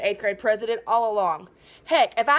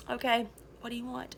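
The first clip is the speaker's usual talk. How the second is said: quiet; 10 dB quieter than usual. Retold or imitated. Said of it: "he was like".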